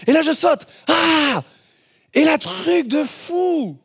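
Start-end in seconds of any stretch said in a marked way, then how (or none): 0.9-1.4 a quick, higher jump yell; short yell. Retold or imitated. Man leaping off with the paraglider, yelling. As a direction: yelp as you leap. Jumping off the cliff.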